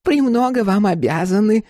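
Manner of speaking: high, polite crone voice; grateful old woman. A narrator's reading. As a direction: thank him sweetly, pitched well up. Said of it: "сказала миссис Холланд".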